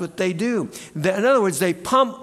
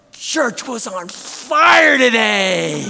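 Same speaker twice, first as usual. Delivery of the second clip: slow, higher, drawn-out Nicholson voice; Jack Nicholson impression; 5 semitones higher, 7 dB louder, much slower than usual. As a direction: do a lazy Nicholson drawl. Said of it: "Jack Nicholson-esque tone".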